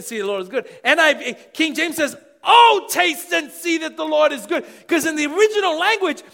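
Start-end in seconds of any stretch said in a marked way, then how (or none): 2.5-4.6 passionate exclamation. Shown as a demonstration. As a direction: proclaim it like the best news ever. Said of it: "it's so intense and so passionate".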